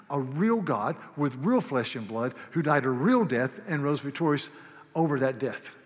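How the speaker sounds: ordinary delivery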